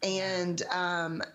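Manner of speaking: usual speaking voice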